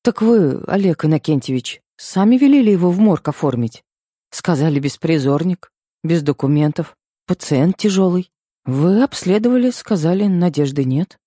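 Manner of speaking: unsure nurse mumble. A narrator's reading. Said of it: "неуверенно пробормотала женщина".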